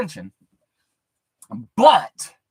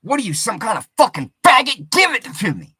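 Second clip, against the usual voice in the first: louder, fast, angry taunt; aggressive demand; 4 semitones higher, 7 dB louder than usual. Retold or imitated. Comic retelling a woman's demand, loud and aggressive. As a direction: snap at him impatiently. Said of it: "she gets more aggressive with it".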